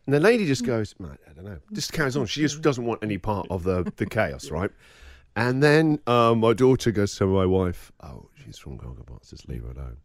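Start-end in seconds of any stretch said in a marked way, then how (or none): 1.0-1.6 low, very soft reply; quiet brush-off. Retold or imitated. TV lady avoiding the wife's questions, quiet brush-off. Reluctant celebrity brushing wife off. 8.0-10.0 very low, very soft aside; discreet hush. Retold or imitated. Embarrassed daughter to her mum in the salon, discreet hush.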